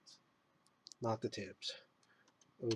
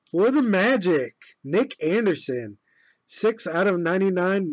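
announcer voice; 3 semitones higher, 7 dB louder than usual. Acted. Announces card pull like a broadcaster.

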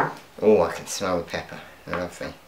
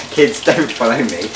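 raised voice, half joking; loud joking warning; 3 semitones higher, 8 dB louder than usual. Real, not acted. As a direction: call out a warning with a laugh. Joking warning after hot oil.

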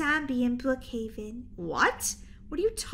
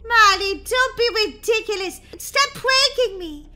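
annoyed sister; 6 semitones higher, 6 dB louder than usual. Acted. Sister dismisses the zombie claim.

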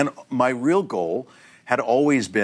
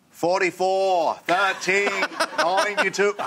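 high, drawn-out New England quarterback call; New England accent; 8 semitones higher, slower than usual. Retold or imitated. Extra overacts quarterback signals on set, New England accent. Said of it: "this story takes place in New England".

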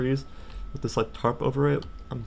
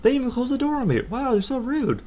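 mock outrage; 9 semitones higher than usual. Mock outrage at rude parents.

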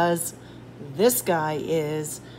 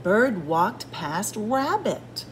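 usual speaking voice

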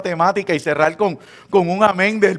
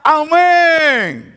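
high, jubilant shout of amen; joyful congregation shout; 8 semitones higher, 3 dB louder than usual. Pretend. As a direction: leap on it, a full-voiced amen. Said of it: "salta y grita".

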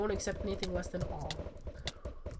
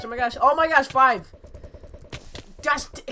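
excited shout; 4 semitones higher, 11 dB louder than usual. Real, not acted. Excited kill streak shout.

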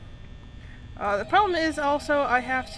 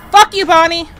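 loud scream at Bonnie; real frightened yell; startled yell; 4 semitones higher, 17 dB louder than usual. Real, not acted. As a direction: shout from a fright. Jump scare at Bonnie.

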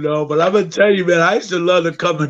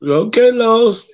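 mom's knowing look; 5 semitones higher, 3 dB louder, much slower than usual. Retold or imitated. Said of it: "she give you that look like".

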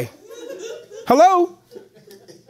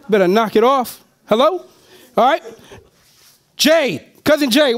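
ordinary talk

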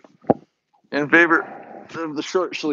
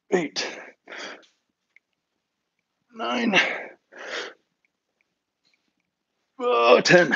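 straining during press; 3 semitones higher, 4 dB quieter, much slower than usual. Real, not acted. Counting reps while pressing weight.

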